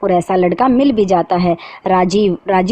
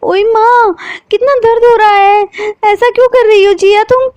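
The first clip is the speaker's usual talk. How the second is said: much higher, loud pained cry; 6 dB louder than usual. A narrator's reading. Sheetal cries out in pain at Jiya, loud and high. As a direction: yelp as the heel stabs your foot. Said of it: "दर्द से कर्राते हुए कहती है".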